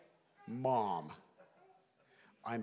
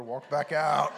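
usual speaking voice

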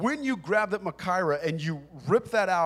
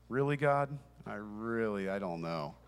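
trailing off lower and softer, unsure; reluctant doubt; 8 semitones lower, 7 dB quieter than usual. Retold or imitated. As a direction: hold back the words, hesitant and hushed. Hesitant reply to God's prompting.

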